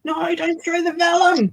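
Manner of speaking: high-pitched mock protest; put-on high wail of protest; mock pleading. Acts out pleading at the screen.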